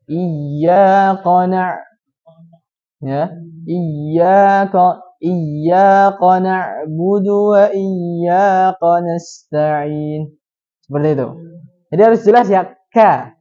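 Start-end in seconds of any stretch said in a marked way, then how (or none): 0.1-10.4 iyyaka read slowly with ka said as ko; slow recitation turning iyyaka's ka into ko; ka turned to ko. Shown as a demonstration. Teacher shows students ka wrongly read as ko. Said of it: "diganti dengan huruf ko".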